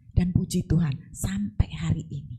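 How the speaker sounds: hushed grateful whisper. Real, not acted. Preacher thanking God for her family, whispered.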